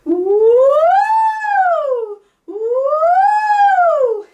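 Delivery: very high, loud held siren woo. Shown as a demonstration. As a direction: stretch the siren out like a tightrope. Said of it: "let's pull the siren out".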